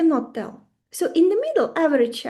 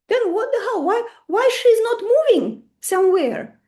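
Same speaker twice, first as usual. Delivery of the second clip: exasperated disbelief; 8 semitones higher than usual. Retold or imitated. Wise man's exasperated question.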